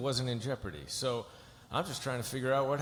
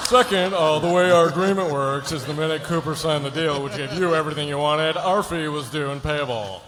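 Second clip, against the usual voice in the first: loud, higher-pitched comic delivery; turned on its end; 3 semitones higher, 9 dB louder than usual. Acted. Lawyer redoing speech to client differently, loud and high. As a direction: belt out the legal speech through laughter. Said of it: "turn it on its end and do something different".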